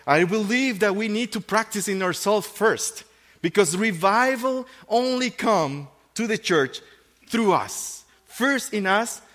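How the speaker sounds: high, loud, rising sermon delivery; a pastor calling the church to revival, preaching hard; full-force preaching. Acted. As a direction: preach it out to the whole room. Preaching to the congregation.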